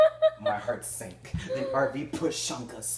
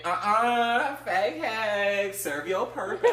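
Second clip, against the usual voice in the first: higher, sassy brush-off; sassy dismissal; 9 semitones higher, 4 dB louder, slower than usual. Pretend. Imagined woman brushing off a man, sassy. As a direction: dismiss her with attitude. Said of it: "She was like".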